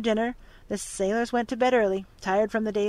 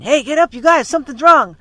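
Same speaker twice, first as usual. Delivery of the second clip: urgent shout; 5 semitones higher, 13 dB louder than usual. A narrator's reading. Don rousing the sleeping crewmen, loud urgent shout. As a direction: shout it loud and high, waking the crew. Don wakes crew in storm.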